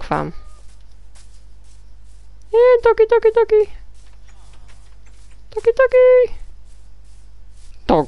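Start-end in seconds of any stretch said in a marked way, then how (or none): 2.5-6.4 much higher, sing-song call to a dog; high-pitched pet-calling voice; high doggy call. Real, not acted. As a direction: coax the dog out in a squeaky high voice.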